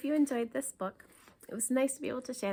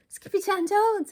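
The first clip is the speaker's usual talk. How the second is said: high, loud call of a name; cry out; 11 semitones higher, 10 dB louder than usual. Acted. Mama and the girls catching him, shouting. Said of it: "everyone cried".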